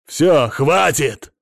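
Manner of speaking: loud, slow, forceful outburst; angry shout. A narrator's reading. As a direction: explode and cut her off. Husband snapping before smashing glass.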